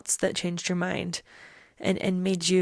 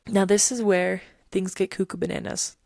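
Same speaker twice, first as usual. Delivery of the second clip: ordinary delivery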